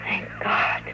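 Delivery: relieved whispered thanks; soft relieved whisper. Acted. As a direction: breathe it out in relief. Relieved whisper after crisis.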